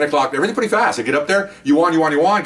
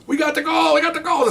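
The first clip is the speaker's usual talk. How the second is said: excited. Retold or imitated. Friend phones with news.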